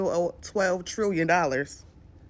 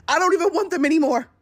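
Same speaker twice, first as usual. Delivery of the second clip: exasperated outburst; 7 semitones higher, 5 dB louder, faster than usual. Real, not acted. Ranting about tax brackets.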